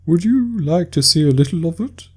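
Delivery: a weepy sea creature struggling through tears; tearful Mock Turtle. Mock Turtle speaking to Alice, high and tearful.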